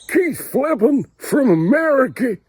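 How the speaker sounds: high, loud showman's build-up; announcing the American guest with excitement; excited announcement. Real, not acted. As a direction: announce the guest like a big reveal.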